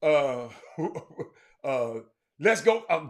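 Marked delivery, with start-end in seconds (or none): none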